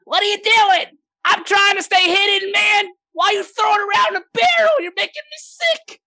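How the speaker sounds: high, loud irate gnome voice; squawking, fist-shaking stowaway; angry gnome. Acted. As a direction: yell up at them like an indignant little gnome. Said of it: "he's shaking his fists at you".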